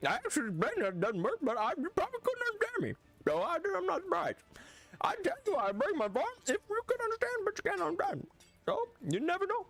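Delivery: high, sing-song mock-Swedish voice; Swedish Chef. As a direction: talk high through puffed-up lips, mock Swedish. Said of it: "Swedish Chef is gonna get me in trouble".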